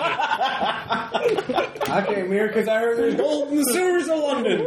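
laughing prospector, high and slow; slow prospector voice through giggles; gruff mountain man. Acted. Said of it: "The old prospector in an English pub".

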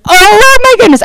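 awed exclamation. Real, not acted. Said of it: "I was terrified".

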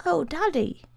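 high small voice near tears of relief; high-pitched boy, relieved and tearful; relieved, tearful child. A narrator's reading. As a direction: let relief spill out as a little boy. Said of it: "began to cry easily with obvious relief".

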